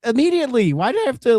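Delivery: high-pitched retold exasperation; exasperated complaint. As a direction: plead in indignant frustration. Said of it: "But it's like".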